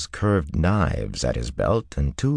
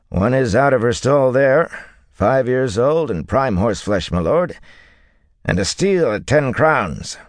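higher, louder salesman voice; proud seller, pitched well up; horse dealer's pitch; 8 semitones higher, 5 dB louder than usual. A narrator's reading. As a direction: talk up the horse to a lord. Horse dealer makes his pitch.

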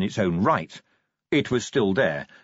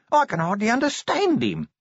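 nasal corporal voice; 11 semitones higher than usual. Acted. Nobby voiced in read story.

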